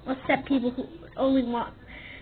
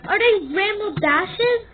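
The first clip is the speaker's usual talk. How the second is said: ordinary delivery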